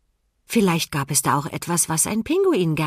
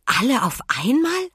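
hohe, ungläubige Frage; staunend; 8 semitones higher, 3 dB louder than usual. A narrator's reading. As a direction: staune mit steil steigender Stimme. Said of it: "staunte Emma". (erstaunt nachgefragt)

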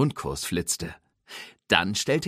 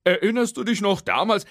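folksy tow truck; 9 semitones higher, 6 dB louder than usual. Acted. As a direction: tow truck Hook teasing his friend, high and drawling. Hook's voice.

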